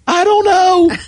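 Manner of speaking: high, put-on teenage girl voice; whiny girl voice. Coach mimicking the nervous sister's answer, high whiny girl voice. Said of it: "She said".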